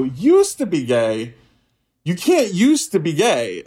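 slow, drawn-out disbelief; incredulous objection. Retold or imitated. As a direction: stretch each word in mock disbelief. Disbelieves counselor's claim.

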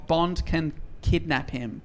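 normal talking voice

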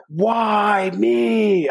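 high, whiny cry to the sky; exasperated wail. Retold or imitated. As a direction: wail it like the world is against you. Finding four feet of snow.

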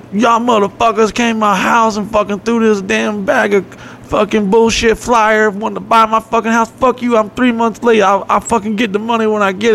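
high, put-on voice of a fed-up homeowner; angry homeowner impression.

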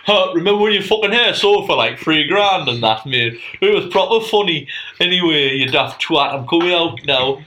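loud, high laddish guest voice; mystery guest voice. Pretend. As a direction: shout it like a cheeky guest on the show. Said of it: "you'd come over and go".

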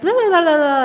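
high, loud mock kid gibberish; unclear toddler babble. Kids coming in asking for something, mock babble. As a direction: babble like a small child nobody understands. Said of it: "didn't speak clearly very early on".

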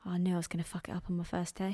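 fast, whispered fretting; whispered panicked quote. Retold or imitated. Friend quoting the nervous film character, fast whisper. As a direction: rush it out under your breath.

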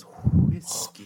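"Whiskey" with a long airy "hwh" start; exaggerated breath before "Whiskey"; double-H whiskey. Shown as a demonstration. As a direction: blow a big H into "Whiskey". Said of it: "Two H's. That's how good it was".

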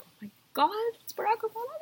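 high starstruck fangirl voice; mock starstruck. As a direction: gush over Barack Obama like a fan. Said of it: "I'd be like".

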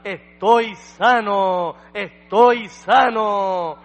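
loud, high, drawn-out cry of healing; imitating a healed man's exultant shout. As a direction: shout it jubilantly, stretching each word. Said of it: "empezó a gritar".

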